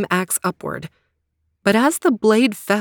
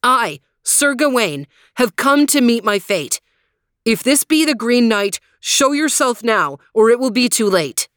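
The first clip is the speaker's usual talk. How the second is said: higher, louder brave knight; young knight voice; 3 semitones higher, 3 dB louder than usual. Acted. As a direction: challenge the foe boldly. Gawain's brave challenge.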